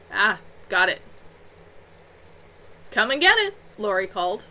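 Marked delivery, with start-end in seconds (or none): none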